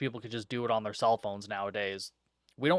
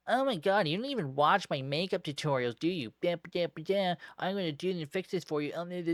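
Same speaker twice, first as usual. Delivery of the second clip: catty valley girl imitation; 5 semitones higher, faster than usual. Imitating Gina mocking Heidi.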